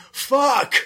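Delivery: high-pitched scream at ordinary volume; mock scream. Retelling how Dexter screamed in agony, mock scream. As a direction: imitate an agonised scream without the volume. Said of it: "Dexter screams so much that he screams".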